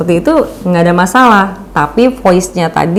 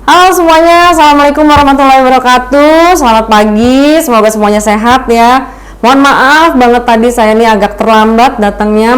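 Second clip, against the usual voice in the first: energetic, enthusiastic opening; 2 semitones higher than usual. Shown as a demonstration. Presenter opening a talk to the audience, brimming with energy. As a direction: greet the room like you're thrilled to be there. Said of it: "kalau misalnya ngebawainnya dengan cara".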